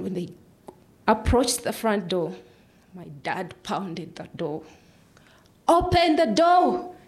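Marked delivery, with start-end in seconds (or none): 5.7-6.9 loud, high, drawn-out shout; father's scream. Retold or imitated. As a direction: scream to get the door opened. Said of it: "He started screaming".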